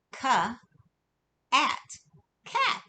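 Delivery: K, at, cat blended slowly, cat rising high; blending k onto at. Shown as a demonstration. Teacher to kids, blending sounds into cat. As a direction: sound out k, then at, then join them into cat. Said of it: "let's put the k sound in front of that".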